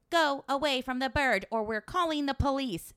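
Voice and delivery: high, loud mock machine voice, like a talking scooter; scooter's robotic warning voice. The scooter warning someone away, in a high, loud mock machine voice. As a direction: play the scooter barking its threat at a loiterer. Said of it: "it starts talking and it goes".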